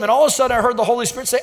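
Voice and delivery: normal talking voice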